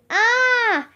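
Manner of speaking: high, drawn-out startled "ah"; startled cry. A narrator's reading. Two children facing a cobra with bared fangs, startled cry. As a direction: cry out in alarm at normal volume.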